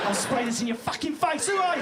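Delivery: rising to a high yell; hammed-up threat from a panicked woman; mock alarmed threat. British woman fends off a follower, mock-alarmed yelling.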